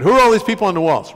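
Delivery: quick, loud, blunt question; very direct. Retold or imitated. Monk asks about the walls, laser direct. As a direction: fire it straight at him. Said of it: "Real direct guy, real laser direct".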